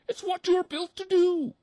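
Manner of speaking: shocked mother duck; squeaky duck mother aghast; gasping mother duck. A narrator's reading. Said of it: "she gasped".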